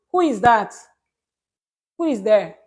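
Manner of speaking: loud, sharp challenge to an intruder; calling out. Real, not acted. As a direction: call out to find who is there. Calls out to someone interrupting.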